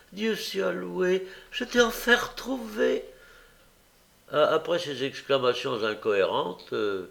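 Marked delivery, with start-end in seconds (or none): 0.1-3.0 high, slow, theatrical thanks; milk the false joy; fake relief. A narrator's reading. Young woman staging a reunion, high and overwrought. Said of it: "se mit à pousser des cris et des gémissements".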